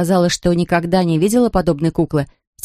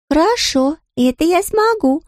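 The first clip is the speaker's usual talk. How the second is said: put-on high girl voice; 11 semitones higher than usual. Girl answers as servant.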